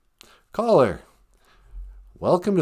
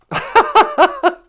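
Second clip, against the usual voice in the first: high squealing laugh; 6 dB louder than usual. Real, not acted.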